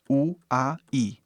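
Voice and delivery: "U A I" as three separate vowel sounds; soletrado por sons. Shown as a demonstration. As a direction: sound out "U A I" one vowel at a time. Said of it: "que de pronúncia fica". ("U A I" dito vogal por vogal, separado)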